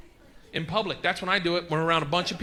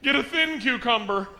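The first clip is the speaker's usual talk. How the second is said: loud, high call that eases off; calling across store; 7 semitones higher, 3 dB louder, slower than usual. Retold or imitated. Husband shouting to wife in the grocery store, calling out. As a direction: holler it so everyone around hears.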